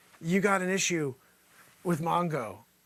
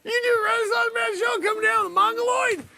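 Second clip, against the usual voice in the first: high, loud, crying with laughter; helpless laughing breakdown; 14 semitones higher, 3 dB louder than usual. Acted. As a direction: shriek through uncontrollable laughter, voice straining up high. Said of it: "You're making me cry. And not because I'm laughing".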